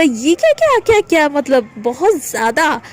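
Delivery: high, stunned क्या क्या क्या; reliving her shock at his vulgar talk; shocked disbelief. Retold or imitated. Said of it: "मैंने कहा".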